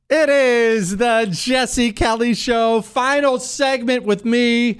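announcer voice. Acted. Show ident after break.

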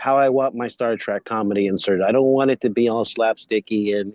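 ordinary delivery

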